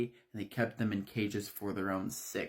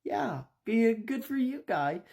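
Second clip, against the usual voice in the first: mock congratulation; 11 semitones higher than usual. Mocking reply to winner.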